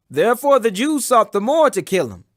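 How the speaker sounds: ordinary delivery, nothing marked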